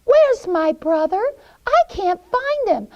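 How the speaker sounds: put-on high, loud little-girl voice; anxious Gretel. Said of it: "she says to the witch".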